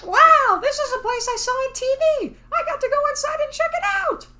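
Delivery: put-on very high, wide-eyed boy; excited kid voice. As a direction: gush like an amazed kid. Voicing excited kid character.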